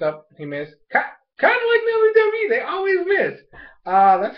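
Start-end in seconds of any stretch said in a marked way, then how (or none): 1.4-3.4 very high squealed aside; incredulous outburst. Real, not acted. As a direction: shoot way up in pitch, exclaiming. Mocking wrestling, voice leaps up.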